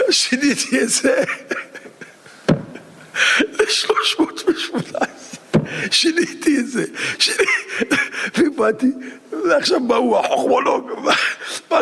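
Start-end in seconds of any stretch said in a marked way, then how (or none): 0.0-7.4 a laugh running into the words; laughing while telling. Retold or imitated. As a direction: chuckle through it. Driver laughing telling trick. (צוחק תוך כדי)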